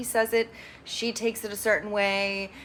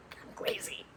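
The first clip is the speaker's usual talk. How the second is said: mock whisper; 13 dB quieter than usual. Abby's retold reply.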